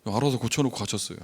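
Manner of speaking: ordinary delivery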